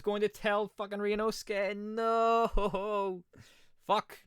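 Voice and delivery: high, dismayed outburst; blurting out in real dismay; dismayed outcry. Real, not acted. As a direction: caught off guard and cursing. Player reacts to the twist.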